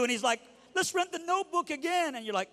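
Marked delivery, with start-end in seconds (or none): 0.7-2.1 put-on high, whiny weepy-boyfriend voice; mock crying. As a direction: whimper it out, hammed-up. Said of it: "when he's crying more than you".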